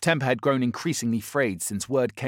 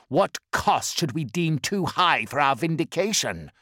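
slow, higher and louder, fierce; vengeful vow; 8 semitones higher, 8 dB louder, slower than usual. Acted. Bellerophon vowing vengeance on Guilliman, fierce. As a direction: swear revenge with burning hatred.

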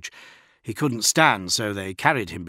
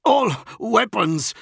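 coughing out orders; choked order; 12 semitones higher, 6 dB louder, slower than usual. A narrator's reading. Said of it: "he coughed".

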